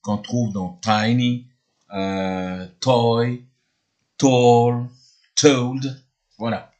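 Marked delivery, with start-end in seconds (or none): 2.8-6.0 toy, tall, told with a crisp English t, louder; clear English t. Shown as a demonstration. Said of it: "comme le son t". (t anglais net et appuyé)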